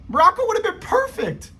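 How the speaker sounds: high, excited insistence. Real, not acted. Vlogger insisting to viewers, high and excited. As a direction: pitch it way up with excitement. Repeats the claim, much higher.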